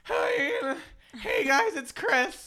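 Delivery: high, honking goose voice. Pretend. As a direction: honk it like a goose. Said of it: "me honking into the mic".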